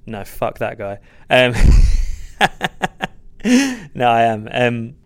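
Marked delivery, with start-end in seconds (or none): 1.6-3.9 loud high laugh. Real, not acted. Laughs after "fuck that guy.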